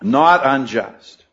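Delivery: "not unjust" slow, "not" stressed. Shown as a demonstration. Preacher naming the double negative, slow with stress on "not". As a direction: pull "not unjust" apart slowly, weight on "not". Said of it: "we have the double negative".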